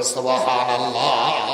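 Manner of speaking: long-held sermon exclamation; drawn-out praise. Real, not acted. Preacher leading the crowd in praise, drawn out. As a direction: stretch the last syllable out for the congregation.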